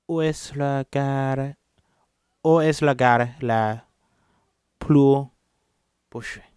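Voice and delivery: French read slowly with a Spanish lilt; Spanish-tinged French. Shown as a demonstration. Reader asking for the station, French with a Spanish accent. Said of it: "I'm trying to speak Spanish".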